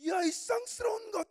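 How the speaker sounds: high, drawn-out mock scolding; scolding grandfather. Old grandfather telling off immodest dancers, mock scolding. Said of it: "할아버지들 야단칩니다".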